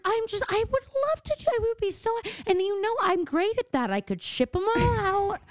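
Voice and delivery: high, gushing mom voice; begging mom. Retold or imitated. Daughter mimics mom begging to ship merch, high and gushing. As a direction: beg to help like an overeager mom.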